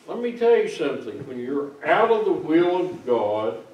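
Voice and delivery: usual speaking voice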